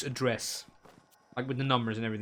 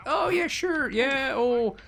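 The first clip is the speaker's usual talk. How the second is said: mock breezy help desk; 5 semitones higher than usual. Help desk fobbing her off again.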